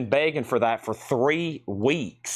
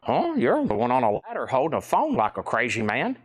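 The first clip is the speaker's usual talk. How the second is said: Otis voice. Retold or imitated. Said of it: "He's probably like".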